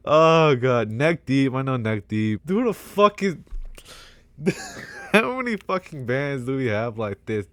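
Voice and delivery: usual speaking voice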